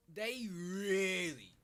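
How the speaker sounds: "really" stretched out, high and loud; emphatic REALLY. Real, not acted. Complaining about put-on Scousers, "really" loud and drawn out. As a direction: lean hard and long on "really". Stressing how much they exaggerate.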